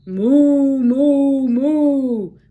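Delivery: loud mooing cow. Acted. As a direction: call out like a cow waiting. Cow voiced in story.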